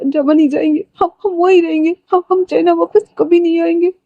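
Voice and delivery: high, tearful begging broken by sobs; frantic weeping wife begging to go back to Germany; crying plea. Acted. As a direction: plead through tears, stammering and voice cracking high. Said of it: "Sanaya रोते हुए कहती है".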